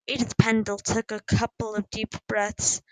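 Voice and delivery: plain reading voice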